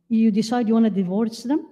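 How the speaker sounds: usual speaking voice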